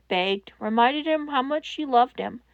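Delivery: plain reading voice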